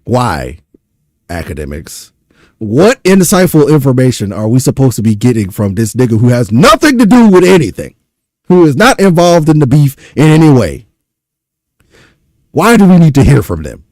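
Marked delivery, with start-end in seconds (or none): 2.6-13.8 loud, exasperated questioning; indignant outburst, pushing louder. Real, not acted. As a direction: demand an answer, raising your voice. Exasperated rant at the clip.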